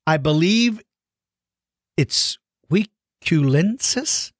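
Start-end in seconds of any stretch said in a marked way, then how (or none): 2.7-4.3 "Huiculensis" sounded out slowly, rising like a question; unsure pronunciation. Real, not acted. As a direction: feel your way slowly through "Huiculensis", starting it with a w sound. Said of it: "I don't know how it's pronounced".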